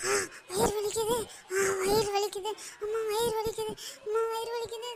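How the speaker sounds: tearful moaning, clutching the belly; crying in pain. Acted. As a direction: whimper through the stomach cramps. Poisoned, stomach pain. (வயிற்று வலியில் அழுகை)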